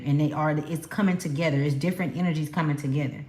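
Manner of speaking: usual speaking voice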